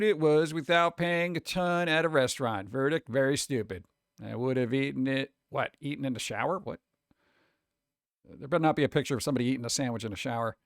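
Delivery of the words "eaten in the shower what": ordinary delivery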